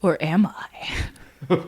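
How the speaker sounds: slow, breathy mock-mysterious whisper; spooky whisper. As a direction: whisper it slowly like a secret twist. Teasing twist about her story.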